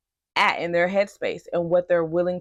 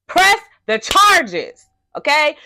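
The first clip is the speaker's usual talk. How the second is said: high, loud, slow laughing drawl; hammed-up emphasis on "Press the charges, okay?"; mock emphatic, laughing; 9 semitones higher, 9 dB louder, slower than usual. Shown as a demonstration. Repeats her advice, mocking emphasis.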